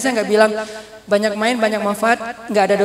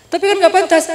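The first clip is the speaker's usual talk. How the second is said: high, louder mock protest; retold indignant protest; 8 semitones higher, 5 dB louder than usual. Father protesting his daughter's behaviour, indignant. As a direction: object with rising indignation.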